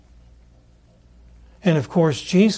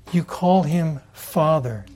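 ordinary delivery

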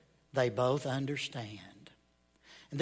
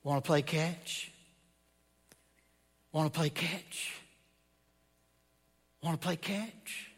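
heavenly voice. Acted. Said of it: "That heavenly voice".

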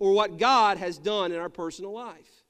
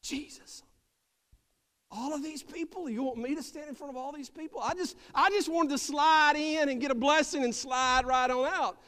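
frightened woman pleading; 5 semitones higher than usual. Acted. Woman in the crowd begs Jesus not to make her speak, high and hushed. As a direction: breathe it out, nervous and pleading.

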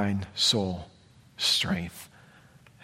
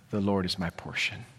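much softer, lower, inward; soft confession; 6 semitones lower, 9 dB quieter than usual. Acted. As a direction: say it as the soul's hushed vow. Soul's quiet declaration.

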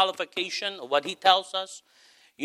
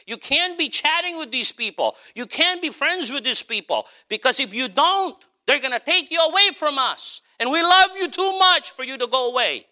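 pleading parent; 9 semitones higher than usual. Retold or imitated. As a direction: beg your child to stay, a touch of pleading. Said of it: "And so I say".

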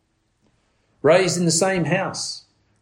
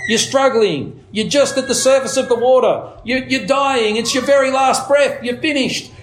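rising, urgent, climbing higher with each line; urgent drowning picture; 7 semitones higher than usual. Retold or imitated. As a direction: paint the drowning man's last breath. Evangelist's drowning illustration retold.

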